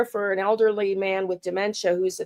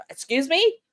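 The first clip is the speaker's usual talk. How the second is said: indignant; 8 semitones higher than usual. Real, not acted. Indignant reply to the IRS.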